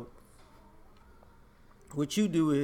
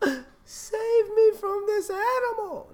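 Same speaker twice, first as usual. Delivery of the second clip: much higher, slow, hammed-up wail; mock fake crying victim; 5 dB louder, much slower than usual. Mocking a fake victim begging strangers, in a much higher, slow wail.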